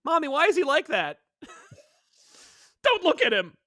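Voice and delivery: put-on high-pitched kid asking mommy; whiny child. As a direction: play a little kid pointing at a stranger. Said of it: "child voiced in retold scene".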